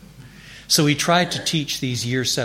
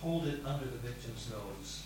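normal talking voice

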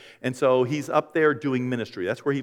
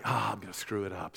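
softer, nervous aside to himself; worried mutter; 6 semitones lower, 10 dB quieter than usual. Retold or imitated. Pastor fretting about the wedding, softly worried. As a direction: mumble the fear under your breath. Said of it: "I'm just like".

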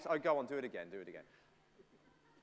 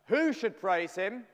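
louder and slower question; loud call to the room; 5 semitones higher, 9 dB louder, slower than usual. Acted. Preacher loudly prompting the congregation's answer. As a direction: call out to get the answer back.

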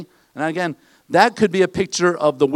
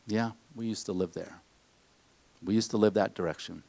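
wistful murmur; 8 semitones lower, 12 dB quieter than usual. Retold or imitated. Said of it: "Adam and Eve looked back".

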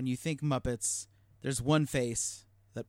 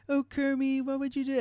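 Miss Piggy swoon; 10 semitones higher than usual. Retold or imitated. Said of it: "when she's just like".

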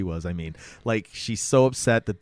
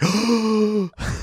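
sharp high gasp of shock; retold high gasp of shock; mock gasp; 10 semitones higher than usual. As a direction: gasp like you just saw it. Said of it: "I was like".

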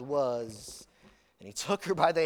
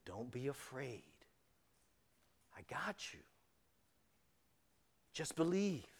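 much softer, hushed reassurance with a whispered middle; tender near-whisper; 4 semitones lower, 13 dB quieter than usual. Acted. Jesus looking the father in the eyes, hushed tender reassurance. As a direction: lean in close and soothe him.

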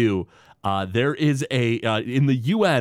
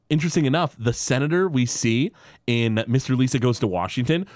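ordinary talk, nothing marked

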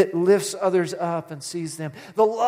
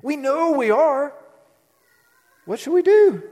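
high, loud rhetorical question; emphatic sermon question; preaching climax; 9 semitones higher, 5 dB louder than usual. Acted. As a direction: call it out to the congregation.

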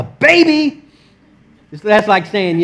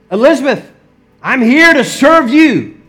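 heartfelt announcement; 7 semitones higher, 6 dB louder than usual. Retold or imitated. Mary arrives at Elizabeth's to serve, calling out.

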